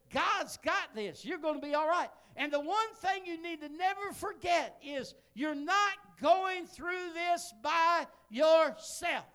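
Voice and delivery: high, loud, slow fervent praying; preacher prays loudly over the sick daughter; loud excited prayer. Retold or imitated. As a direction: pray it out at full volume. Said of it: "I got louder. I got excited".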